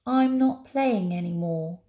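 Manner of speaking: loud, drawn-out sulk; sulky refusal. Acted. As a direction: refuse like a sore loser. Tower sulks after losing.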